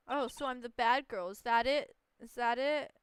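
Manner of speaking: lightly voiced pouting girl; a hint of a sulky witness. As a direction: tease the lawyer with mock hurt. Voices April May sulking.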